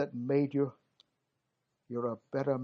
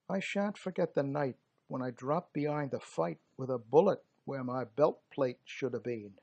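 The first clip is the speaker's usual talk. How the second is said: plain reading voice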